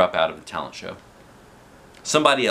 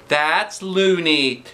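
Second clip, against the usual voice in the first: high, slow put-on voice; teacher character voice; 9 semitones higher, 4 dB louder, much slower than usual. A narrator's reading. Teacher scoffing at the plan, high slow voice.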